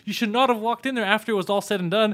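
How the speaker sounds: high, loud exasperated telling-off; scolding a friend. Retold or imitated. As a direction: scold your buddy for overreacting. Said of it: "I was like".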